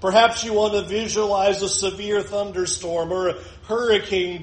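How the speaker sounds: ordinary delivery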